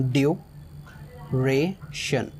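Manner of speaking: Duration said slowly, pausing between its three syllables; syllables split apart. Shown as a demonstration. Teacher splits duration into syllables for students, slow and spaced. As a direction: break duration into its parts slowly. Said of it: "three syllable".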